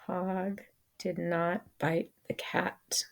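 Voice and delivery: whispered repeat of the sentence, hushed and breathy; soft whispered echo for the class. Shown as a demonstration. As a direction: say it again in a whisper. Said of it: "The hog did not bite the cat".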